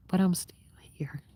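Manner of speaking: breathy whispered aside; real whisper to the listeners. Real, not acted. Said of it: "I feel like I'm whispering to y'all".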